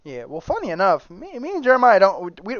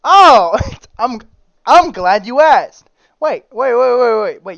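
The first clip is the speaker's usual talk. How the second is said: excited; 4 semitones higher, 7 dB louder, slower than usual. Acted. Excitedly introducing topic wheel.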